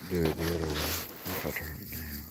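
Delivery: ordinary talk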